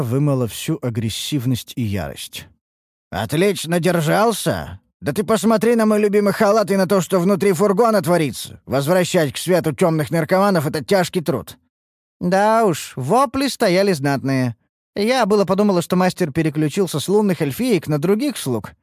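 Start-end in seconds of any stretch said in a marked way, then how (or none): 3.1-11.6 high, worked-up grumbling; indignant complaint. A narrator's reading. Master showing his ruined robe, indignant complaint. 12.2-18.7 high-pitched needling remark; teasing jab. A narrator's reading. Красная to master about the screams, teasing. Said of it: "поддела Красная".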